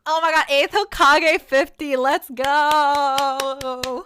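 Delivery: excited cheer. Real, not acted. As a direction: cheer a new subscriber at full volume. Cheers a new subscriber.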